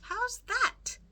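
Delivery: high, loud shout of confusion; Meg shouting. A narrator's reading. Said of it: "shouted Meg".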